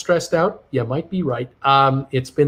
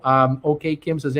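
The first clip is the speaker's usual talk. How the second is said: ordinary talk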